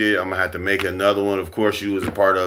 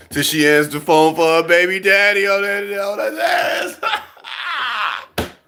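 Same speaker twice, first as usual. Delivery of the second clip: anguished wail; 8 semitones higher than usual. Acted. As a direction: wail it like a heartbroken man. Acting out a guy's wail.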